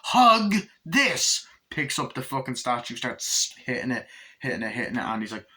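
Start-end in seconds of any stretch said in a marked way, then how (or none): 0.0-1.5 loud, high furious yell in imitation; Chucky. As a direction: shout it as a killer doll's retort. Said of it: "And Chucky's like".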